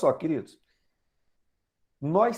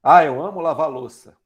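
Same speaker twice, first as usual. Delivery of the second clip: deadpan sarcasm, face saying the opposite; ironic; 5 semitones lower, 7 dB louder than usual. Shown as a demonstration. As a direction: pretend to love washing dishes, clearly not. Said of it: "Então você faz aquela cara assim".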